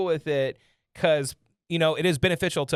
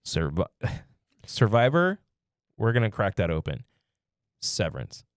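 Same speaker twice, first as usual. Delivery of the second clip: deep seductive hush; sultry voice; 8 semitones lower than usual. Pretend. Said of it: "He went into his sultry voice".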